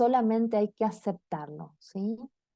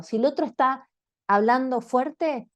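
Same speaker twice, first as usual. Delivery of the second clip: ordinary talk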